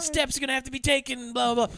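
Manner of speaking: high-pitched mock child tantrum; petulant child. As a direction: act out a spurned little boy losing it. Retold tantrum of movie's child.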